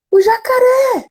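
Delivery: voz de menino pequeno, bem mais aguda; criança assustada. Retold or imitated. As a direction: diga como uma criança com medo. Filho com medo do jacaré. (criança imitada, voz bem aguda)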